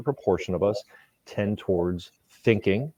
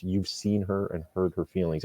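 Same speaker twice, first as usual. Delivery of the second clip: ordinary talk, nothing marked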